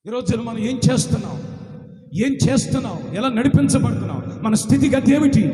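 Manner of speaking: loud, rising sermon appeal; forceful preaching. Acted. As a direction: hammer each question at the church. Preacher building up. (బోధకుడి గంభీరమైన ఉపదేశం)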